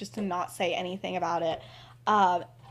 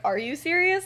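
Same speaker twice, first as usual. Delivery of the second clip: pitched up in disbelief; incredulous question; 7 semitones higher than usual. Acted. James covering for the slip, incredulous. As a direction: ask it like you can't believe it.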